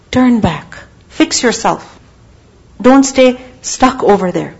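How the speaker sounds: ordinary delivery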